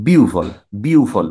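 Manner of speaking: বিউভল said slowly as biu-vol; slow, deliberate biu-vol; correct pronunciation. Shown as a demonstration. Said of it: "এটাকে করব".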